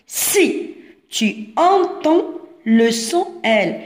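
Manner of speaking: spaced out word by word, very slowly; slow careful reading. Shown as a demonstration. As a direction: read it like dictation to small children. Teacher reads instruction for young pupils. (قراءة بطيئة، كلمة بكلمة)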